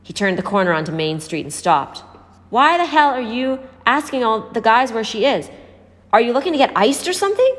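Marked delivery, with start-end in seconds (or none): 2.5-7.6 higher, sharp scolding, quick at the end; fast, pitched-up rebuke. A narrator's reading. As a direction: tell her off for her recklessness. Stranger scolding Raven.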